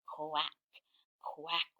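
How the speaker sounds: soft, low quack. A narrator's reading. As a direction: quack gently, holding back. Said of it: "are they being quieter?".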